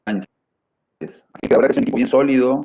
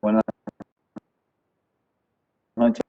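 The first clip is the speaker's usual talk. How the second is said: choppy connection, words cutting out; breaking up over a bad call; buffering stutter; 5 dB quieter than usual. Real, not acted. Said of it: "Se escucha entrecortado".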